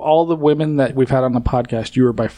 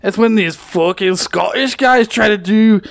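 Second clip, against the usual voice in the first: higher-pitched bad Scottish sliding into surfer dude; awful Scottish accent; 7 semitones higher than usual. Co-host mocking Scottish podcasters' return, awful mixed accent. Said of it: "somewhere between awful, ... awful Scottish accent".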